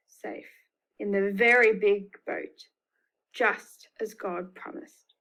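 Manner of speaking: ordinary delivery, nothing marked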